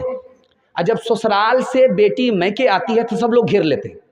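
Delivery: ordinary delivery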